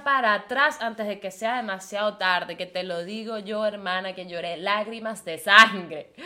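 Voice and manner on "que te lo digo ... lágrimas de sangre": normal talking voice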